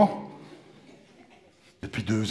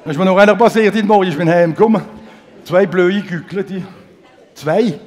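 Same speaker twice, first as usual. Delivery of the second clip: loud outburst; 4 semitones higher, 6 dB louder than usual. Real, not acted. Loud indignant retelling.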